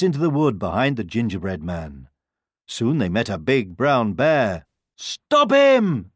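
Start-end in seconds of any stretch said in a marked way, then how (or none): 5.0-6.1 high, loud yell to stop; storybook chasers' high cry; cried out. A narrator's reading. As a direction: shout urgently after a runaway. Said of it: "they cried".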